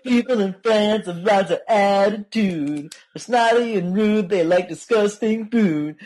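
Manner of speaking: high, slow, hammed-up song voice; unhinged villain. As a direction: ham up a goofy high singsong. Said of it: "he's like".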